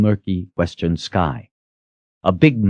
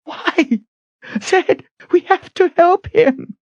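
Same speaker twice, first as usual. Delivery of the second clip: high, slow desperate begging; tearful plea; slower than usual. A narrator's reading. Kalen in tears begging Zed, desperate. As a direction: plead as tears fall.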